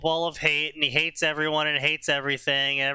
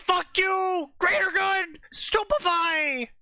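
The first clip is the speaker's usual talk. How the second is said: high shouted spell, stretched slow at the end; mock Dumbledore shout; slower than usual. Dumbledore attacking Sirius, high mock shout. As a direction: yell the curse, drawing out stupefy. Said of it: "Dumbledore's like".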